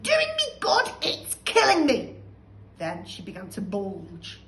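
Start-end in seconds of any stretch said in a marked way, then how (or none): 0.0-2.2 slow, very high, loud screech; panicked shout. Acted. Grandma burning inside, screeching shout. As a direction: shriek for help.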